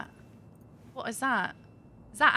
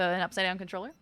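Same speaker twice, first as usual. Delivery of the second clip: ordinary talk, nothing marked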